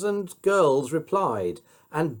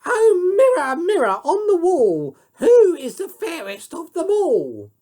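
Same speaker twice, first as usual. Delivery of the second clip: very high, loud vain queen; shrill queen shout; 7 dB louder than usual. A narrator's reading. Spoiled queen calling to her mirror, high shout. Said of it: "the spoiled and stupid queen would shout".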